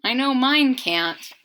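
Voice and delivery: ordinary delivery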